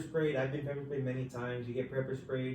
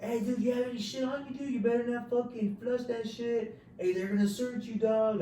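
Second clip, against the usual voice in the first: taunting inmate; 9 semitones higher than usual. Retold or imitated. Inmate taunting new arrival, high mocking yell. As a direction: heckle him from the cell.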